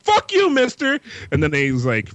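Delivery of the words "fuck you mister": put-on high-pitched bratty kid voice; mock children's defiance, pitched way up; mouthy kids. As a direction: yell back like a cheeky kid. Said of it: "And they're like".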